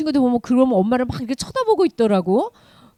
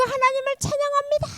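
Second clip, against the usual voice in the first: worshipful praise; 13 semitones higher than usual. Acted. People kneeling to praise God, high fervent voice. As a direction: lift the words up in worship.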